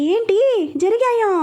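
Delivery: high-pitched exclamation of disbelief; shocked yell. A narrator's reading. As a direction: cry it out in shock, pitch leaping up. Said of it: "గట్టిగా అరుస్తూ అంది".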